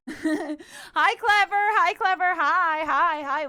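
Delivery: loud, repeated hellos; bubbly loud greeting; excited greeting. Real, not acted. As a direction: call out a loud, happy welcome. Calling out greeting to another viewer.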